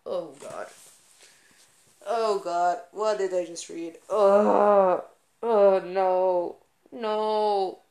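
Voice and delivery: loud, appalled groaning disbelief; horrified. Real, not acted. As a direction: recoil in real horror. Horrified reaction to reading.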